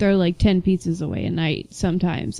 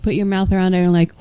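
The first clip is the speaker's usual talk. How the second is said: ordinary talk, nothing marked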